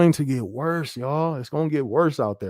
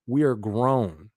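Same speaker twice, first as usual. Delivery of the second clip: "We are grown" spelled out word by word, slowly; slow and deliberate; much slower than usual. Real, not acted. Streamer lectures the angry viewer, slowly. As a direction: drag out "We are grown" to make the point. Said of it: "We're grown".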